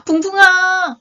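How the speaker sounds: high, loud cry of recognition; shouting. A narrator's reading. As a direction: shout the name in astonished joy. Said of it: "하고 세양지가 소리쳤습니다".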